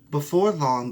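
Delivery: ordinary delivery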